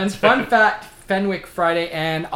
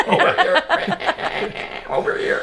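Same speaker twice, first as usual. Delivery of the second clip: mimicked voice clip; 13 semitones higher, slower than usual. Imitates Predator's replayed clips.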